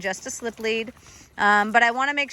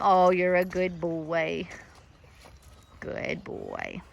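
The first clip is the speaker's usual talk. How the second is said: tender praise; 7 semitones lower, much slower than usual. Real, not acted. Petting the dog.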